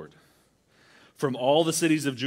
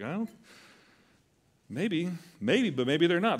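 wheedling doubt; 6 semitones higher than usual. Retold or imitated. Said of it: "You're like".

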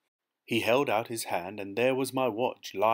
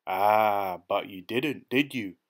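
triumphant; 5 dB louder, slower than usual. A narrator's reading. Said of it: "he said, triumphant".

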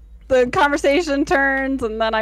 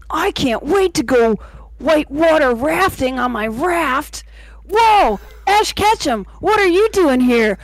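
earnest, eager cartoon hero, louder; anime protagonist voice; 4 dB louder than usual. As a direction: play it like a plucky anime kid. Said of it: "the anime voice?".

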